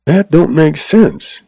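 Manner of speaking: puzzled thought. A narrator's reading. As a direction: think it through, puzzled. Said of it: "he thought as he ran".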